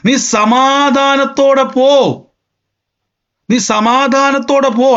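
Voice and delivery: high, slow repeated blessing. Retold or imitated. Preacher repeating Eli's blessing, high and slow. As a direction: sing out each pō slowly. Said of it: "ഒറ്റ ദൂത് കണ്ടോ".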